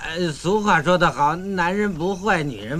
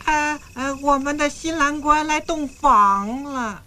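high, slow singsong; playful announcing; 8 semitones higher, slower than usual. Acted. Groom announcing himself at the bridal chamber, singsong. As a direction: draw it out teasingly.

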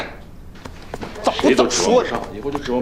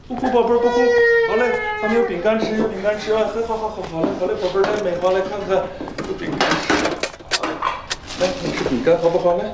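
哄孩子; 9 semitones higher than usual. Acted. 继续哄婴儿吃饼干.

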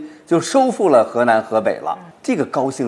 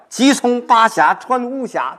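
higher and louder, eager to get home; urgent recitation; 12 semitones higher, 5 dB louder, slower than usual. Shown as a demonstration. Professor showing how the poet hurries home. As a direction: call it out high and eager. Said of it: "后边的节奏加快了".

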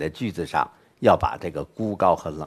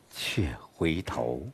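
却回头 drawn out, much softer and lower; slow turn-back; 5 semitones lower, 10 dB quieter, much slower than usual. Shown as a demonstration. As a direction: let 却回头 sink slowly and quietly, like turning to look back. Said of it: "变化了". (却回头放慢放轻，声音下沉)

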